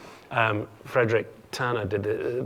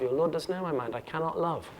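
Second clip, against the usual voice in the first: softer, stock refusal in verse; Olivia's set refusal; 4 semitones higher, 7 dB quieter than usual. Shown as a demonstration. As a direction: recite it softly as her usual line. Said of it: "what she's been saying throughout the play".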